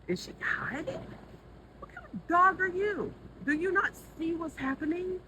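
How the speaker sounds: high, loud scolding of a pet; exasperated high-pitched dog talk; incredulous calling to dog. Real, not acted. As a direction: raise your voice high, questioning the dog. Calls out to the dog.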